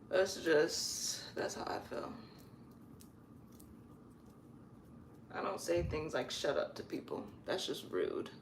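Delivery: near-whisper, very soft. Real, not acted. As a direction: hush it almost to a whisper. Drops to a whisper.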